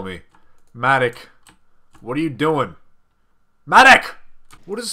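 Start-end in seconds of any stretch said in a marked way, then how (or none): 3.7-4.9 sudden loud high shout of a name; calling out. Real, not acted. Calling out to the enemy player Matic with a loud high shout. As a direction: yell the name across the room.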